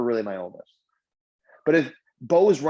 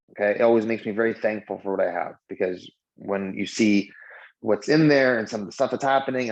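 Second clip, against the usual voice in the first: usual speaking voice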